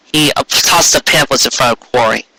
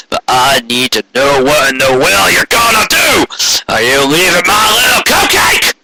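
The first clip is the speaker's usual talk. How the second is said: higher, strained roar, barely civil; 6 semitones higher than usual. Acted. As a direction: roar at him, fighting to stay calm. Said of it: "roared Corey".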